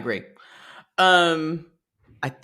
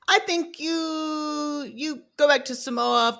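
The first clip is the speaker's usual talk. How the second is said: high, drawn-out mother-in-law impression; Moneybags; 10 semitones higher, 3 dB quieter, slower than usual. Mother-in-law telling son to replace his wife, high mocking voice. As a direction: stretch it out high and soft, mocking her. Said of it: "Moneybags says".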